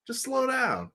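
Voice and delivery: ordinary talk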